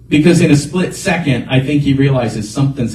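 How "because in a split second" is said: normal talking voice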